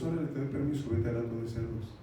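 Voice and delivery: low, soft, unbothered permission; Jesús, calm. Acted. Jesus letting demons enter the pigs, calm. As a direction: grant it quietly, in full control. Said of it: "Pero con calma".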